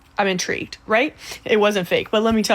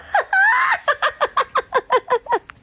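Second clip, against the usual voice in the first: high, long peal of laughter; real cackle, high and sustained; gleeful cackle; 4 dB louder than usual. Real, not acted. Trickster delighted as the man phones his girlfriend, gleeful cackle.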